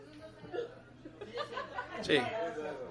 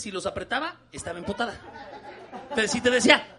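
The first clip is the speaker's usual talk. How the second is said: usual speaking voice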